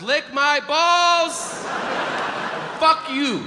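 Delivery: high, stretched mock yell; mimicking a kid's involuntary outburst; imitated tic outburst. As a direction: blurt the curse way up high.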